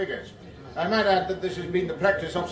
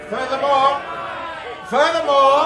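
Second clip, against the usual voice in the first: raised over heckling; 4 semitones higher, 6 dB louder, slower than usual. Acted. Minister trying to speak over uproar.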